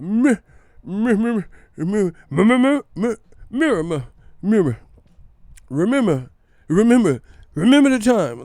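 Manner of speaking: high, loud stammered false starts; Tim Bradley, struggling stutter. Retold or imitated. Mocking a commentator straining for Ramirez, loud stutter. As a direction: force out the syllable, then grab a wrong word. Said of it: "he was struggling so bad".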